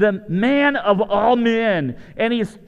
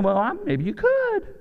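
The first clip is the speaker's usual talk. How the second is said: much higher wavering mock king; hesitant king; 12 semitones higher than usual. King Saul wavering over David, hesitant high voice. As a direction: waver like a doubtful old king. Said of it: "the king's going".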